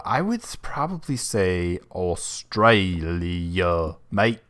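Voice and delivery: drawn-out, badly faked Australian accent; clumsy mock-Australian, very slow on the country name. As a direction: stretch it slowly into a hammy Aussie accent. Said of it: "my bad impression of an Australian accent".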